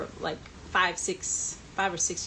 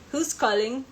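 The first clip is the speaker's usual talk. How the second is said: loud, higher mock Cockney; British accent; 5 semitones higher, 5 dB louder than usual. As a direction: imitate a Cockney assistant screening calls. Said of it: "he'd answer in a British accent".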